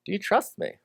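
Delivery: God speaking softly to the doubter; gentle question. Acted. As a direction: ask quietly, inviting trust. God's voice asking gently.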